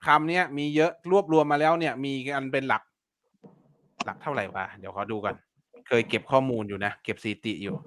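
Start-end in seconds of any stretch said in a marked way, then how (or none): 4.1-7.8 low, softened aside while checking; talking to himself. Real, not acted. Teacher checking his own keyword records, talking quietly to himself. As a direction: speak softly and low, as if to yourself.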